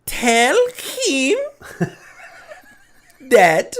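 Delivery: high, very slow fake Russian accent; KGB voice. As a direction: overblown Russian impression, each word stretched. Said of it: "he does this funny voice".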